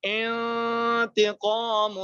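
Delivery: انتقام with the نون hidden in a long nasal hum; slow Quranic انتقام with ghunna; nasal ikhfa. Shown as a demonstration. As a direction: stretch انتقام slowly, the نون sounding through the nose. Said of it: "ناک میں آواز جائے گی".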